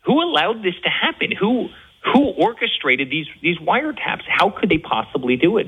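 high-pitched incredulous questioning; real indignation. Real, not acted. Guest protesting wiretaps to the host, indignant. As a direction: demand answers, pitch riding high.

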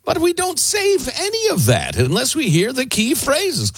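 high-pitched parody of a company's assurance; mock corporate excuse.

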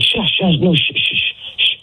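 high shushes thinning into voiceless hisses; mock frantic shushing, trailing into pure "shh"; imitated shushing. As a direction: mimic someone urgently hushing you. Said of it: "She's telling me to, to shush".

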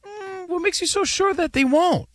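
high, long hmm then a doubting question; sceptical thought. Retold or imitated. Co-host recalling what he thought, high exaggerated scepticism. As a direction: voice your inner doubt in a high pitch. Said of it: "I remember thinking".

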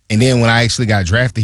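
usual speaking voice

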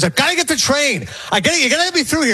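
high-pitched, urgent mock protest; retold incensed commuter. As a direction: imitate an agitated man rushing his plea. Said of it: "a guy's incensed".

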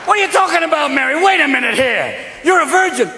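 high, blustering mock shout; exasperated husband hollering, pitched high; Ralph Kramden. Joseph reacting to Mary's pregnancy, blustering high shout. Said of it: "turned Joseph into Ralph Kramden".

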